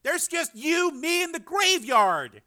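high, strained appeal; urgent insistence. A narrator's reading. Frank to his raving brother, urgent insistence. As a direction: press the point slowly at the end.

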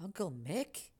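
whisper-like recognition; soft disbelief. A narrator's reading. Dawning recognition.

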